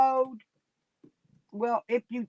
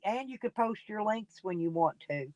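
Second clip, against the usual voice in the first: ordinary delivery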